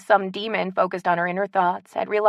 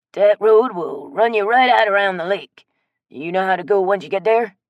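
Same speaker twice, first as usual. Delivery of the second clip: put-on louder, folksy old-timer giving directions; old Cajun man; 8 dB louder than usual. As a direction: point the way like a friendly local.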